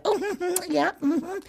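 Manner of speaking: high, laughing gossip voice of the mothers; high, laughing mock society mom; chatty mothers. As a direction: mimic the banquet mothers chirping along. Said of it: "and they like".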